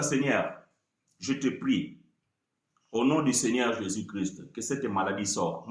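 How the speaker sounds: soft, low, unhurried prayer; understated calm prayer; calm quiet prayer. Shown as a demonstration. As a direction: pray quietly and simply. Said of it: "Si vous disiez comme ça".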